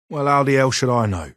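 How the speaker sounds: high, loud irritated retort; exasperated snap. Real, not acted. Gunsmith snapping at the courier's nosy question, loud and high. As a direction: throw the question back, annoyed.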